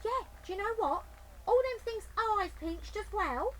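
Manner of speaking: high, loud lady voice; eager agreement; Burglar Betty voice. Acted.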